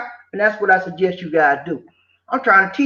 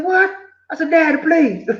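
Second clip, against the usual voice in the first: higher, desperate plea; pleading child; 8 semitones higher than usual. Retold or imitated. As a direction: beg like a scared little boy.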